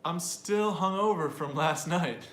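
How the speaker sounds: slow, loud, emphatic phrase reading; slow model reading. Shown as a demonstration. Teacher presents the Thanksgiving phrase, slow and emphatic. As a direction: read it out big and slowly for learners. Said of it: "The phrase reads".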